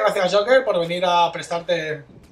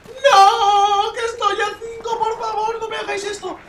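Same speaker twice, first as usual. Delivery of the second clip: tearful begging; 10 semitones higher than usual. Real, not acted. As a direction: plead desperately, losing strength. Begging as the match ends.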